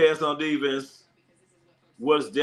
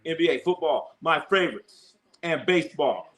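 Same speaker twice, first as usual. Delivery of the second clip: ordinary talk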